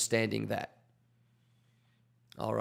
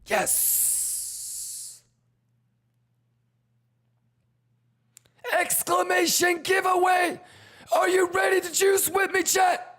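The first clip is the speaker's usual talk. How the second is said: hype shouting; slower than usual. Real, not acted. Giveaway hype.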